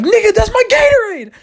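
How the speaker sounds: high-pitched, indignant yell; outraged shout. Acted. Protesting to mom over his Gatorade, outraged shriek.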